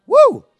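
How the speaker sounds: high, loud celebratory woo; excited whoop. Real, not acted. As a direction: whoop like the crowd just scored. Crowd cheer after the word.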